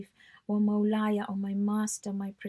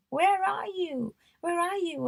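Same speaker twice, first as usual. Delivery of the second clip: anguished cry; 7 semitones higher than usual. Shown as a demonstration. Crying out to God in dua.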